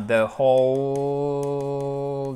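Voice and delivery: WHOLE held very long, "the hooole"; very slow, sustained "the whole"; drawn-out word. Shown as a demonstration. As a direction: stretch the vowel of "whole" right out. Stretches the word to demonstrate.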